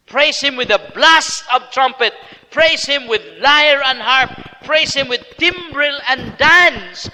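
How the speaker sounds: slow, loud, high psalm proclamation; slow, loud, high ringing recitation; fervent scripture reading. Acted. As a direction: proclaim each line as a call to praise. Preacher reads psalm fervently.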